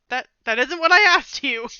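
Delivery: loud exasperated retort. Acted. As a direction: raise the voice, fed up.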